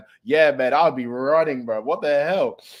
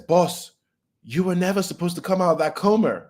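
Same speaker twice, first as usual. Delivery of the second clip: higher, pleading retold Chisaki; voiced Chisaki; 7 semitones higher than usual. Chisaki begging his boss, higher pleading voice. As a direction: lightly voice the villain begging.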